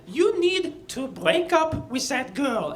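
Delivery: slow, high, firm French teacher; French accent. Retold or imitated. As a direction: order him in French accent. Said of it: "she spoke like this".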